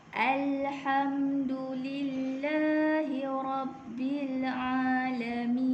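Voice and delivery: slow, measured tajwid recitation; Quran recitation. Shown as a demonstration. Teacher recites the second verse for students. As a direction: chant it slowly with long held vowels. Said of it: "bacaan untuk ayat yang kedua ni ialah".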